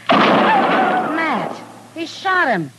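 a sudden high, loud cry of horror that stays high; shocked cry. Acted. Kitty watching Springer shoot the dog, crying out in shock. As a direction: gasp out the words, stunned that he shot the dog. Saloon girl sees dog shot.

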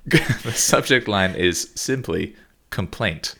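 slow deadpan build to "complaint"; slow teasing announcement; slow reveal. Real, not acted. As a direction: draw it out for comic effect. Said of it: "building the reveal".

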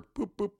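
light, high little "boop, boop" sound effect; tapping sound effect; playful boops. Shown as a demonstration. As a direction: chirp the boops up high and soft. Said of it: "flipping with a finger".

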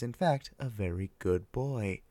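usual speaking voice

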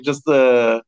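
ordinary talk

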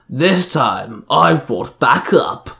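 slow villain sneer. A narrator's reading. Villain reveals his backup to the Rangers, sneering. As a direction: sneer it, stretching the words out. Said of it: "Banjan sneered".

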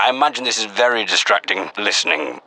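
normal talking voice

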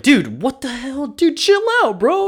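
high-pitched mock Clark telling Lex to chill. Clark telling Lex to calm down, high mock voice. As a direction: squeak it out like a startled bro. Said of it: "Clark's like".

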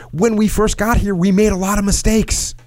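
emphatic re-quote. Shown as a demonstration. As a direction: hit it high to stress the admission. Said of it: "He says".

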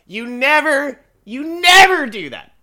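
NEVER pitched high and loud; exasperated, with "never" stretched and belted; emphatic warning. Real, not acted. Emphatic gun safety rebuke.

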